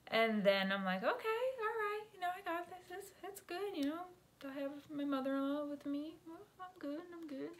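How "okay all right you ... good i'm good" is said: high, small reassuring voice; self-reassuring squeak. Retold or imitated. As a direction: reassure yourself in a high little voice. Acting out her own self-reassurance.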